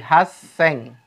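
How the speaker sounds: "Haseng" slowly, with a doubled s; slow "Haseng", holding the middle s; repeated contracted form. Shown as a demonstration. Teacher repeating for learners, slow doubled s. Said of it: "Saya ulang lagi".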